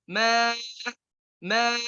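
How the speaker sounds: ma with a thick, rounded heavy a; heavy Arabic ma. Shown as a demonstration. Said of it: "Ma itu harful alif jadi tebal".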